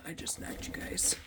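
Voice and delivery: soft unvoiced whisper; whispered aside. Real, not acted. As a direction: whisper it under your breath. Knocked over items, whispers.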